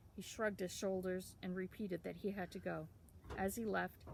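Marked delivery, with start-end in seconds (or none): none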